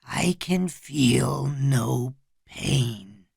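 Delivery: whispery, very slow trance voice; slow, toneless-breath echo from under hypnosis; gravelly, hypnotized. A narrator's reading. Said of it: "In his gravelly, slow-moving, hypnotized voice".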